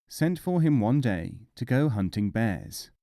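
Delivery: ordinary delivery, nothing marked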